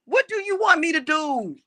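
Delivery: angry repeat. Real, not acted. Repeated rhetorical question.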